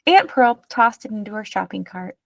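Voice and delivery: plain reading voice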